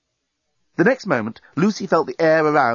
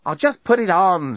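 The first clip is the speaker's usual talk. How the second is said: impatient raised voice; exasperated shout; 7 semitones higher than usual. A narrator's reading. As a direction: snap it out, fed up. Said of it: "shouted Lucy".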